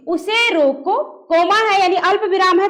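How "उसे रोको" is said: "उसे रोको" drawn out slowly, then stop for the comma; pause after रोको. Shown as a demonstration. Teacher showing pupils how a comma is read, pausing after "रोको". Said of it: "ऐसे बोलेंगे".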